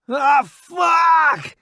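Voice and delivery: high, loud cry of pain; pained scream. Acted. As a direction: scream as the bullet hits. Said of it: "he screams".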